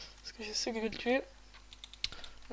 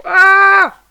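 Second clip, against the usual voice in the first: cri aigu très fort; cri de surprise; 9 semitones higher, 27 dB louder than usual. Real, not acted. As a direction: sursaut hurlé. Se fait attaquer. (cri surpris)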